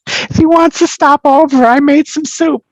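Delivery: put-on very high, slow falsetto old lady voice; friend's mother inviting him over for soup, high falsetto; friend's mother impression. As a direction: squeak it out like a sweet Italian mom inviting you over. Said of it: "she's like, she's like".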